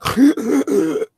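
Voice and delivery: a high, strained gasping breath; imitated gasp. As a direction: mimic a winded man sucking for air. Imitating a man who couldn't breathe.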